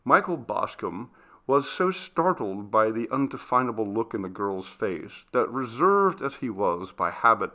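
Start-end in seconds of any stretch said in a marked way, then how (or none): none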